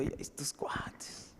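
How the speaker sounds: slow whisper under the breath; slow secretive whisper; whispered aside. Retold or imitated. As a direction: whisper so the cops can't hear. Muttering aside about the cops.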